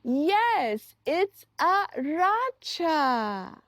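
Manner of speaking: ordinary talk, nothing marked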